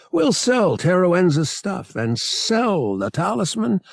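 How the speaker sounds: high, drawn-out enthusiasm; sweeping, slow and eager; expansive. A narrator's reading. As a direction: sell the plan grandly, stretching the words. Han grandly lays out his plan.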